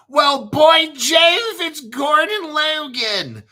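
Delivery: high, loud mock Northern English accent; hammed-up Northern English voice, loud and high; Northern English bloke. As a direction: ham up a Northern England pub bloke. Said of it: "it's a bloke from Northern England".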